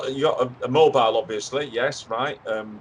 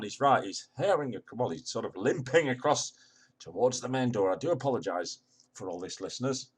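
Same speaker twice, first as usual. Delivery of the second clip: hushed commentary; 2 semitones lower, 7 dB quieter than usual. Acted. Hushed commentary, apologising to listeners.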